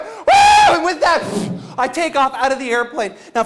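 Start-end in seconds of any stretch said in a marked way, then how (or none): 0.0-0.2 wild whoop. Retold or imitated. Crazed jumper answers the jump master, wild whoop. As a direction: let out a crazed high yell.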